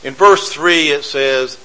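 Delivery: normal talking voice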